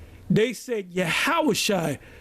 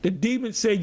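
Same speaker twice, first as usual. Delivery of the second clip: ordinary talk, nothing marked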